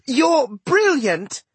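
put-on high, slow, gushing girl's voice; delighted praise. A narrator's reading. Sister kisses the dwarf on the cheek, gushing praise.

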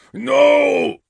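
high, loud, defiant shout. A narrator's reading. Orto defies the phantom, shouting. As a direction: bellow your refusal. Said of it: "shouted Orto".

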